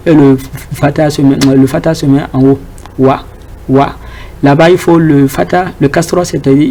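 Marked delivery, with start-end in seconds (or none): 3.0-3.9 Wa repeated, lips open; teacher drilling Wa; demo syllable wa. Shown as a demonstration. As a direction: model Wa twice for the class. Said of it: "il faut casser tes lèvres".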